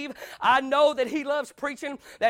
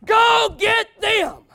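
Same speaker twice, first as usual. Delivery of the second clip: drawn-out loud higher bark of greed; greedy shout; 8 semitones higher, 10 dB louder, much slower than usual. Retold or imitated. Temple sellers spotting a victim, slow loud greedy shout. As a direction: call it out slow and loud like a hustler spotting a mark. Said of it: "could see people coming ... and thought".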